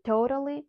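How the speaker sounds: "totally" with "O" as in "go" and a flapped T. Shown as a demonstration. Teacher introducing the word's pronunciation, "totally" with flapped T and "go" vowel. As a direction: tap the second T lightly in "totally". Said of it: "O as in go".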